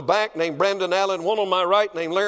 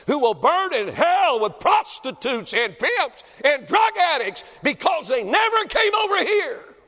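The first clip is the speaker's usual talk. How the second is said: shouted, much higher and louder, rising to a cry; preacher's shout; 11 semitones higher, 4 dB louder than usual. Acted. Preacher warns the churchgoers of hell, shouting. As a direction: blast it at the congregation.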